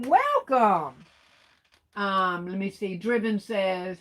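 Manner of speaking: normal talking voice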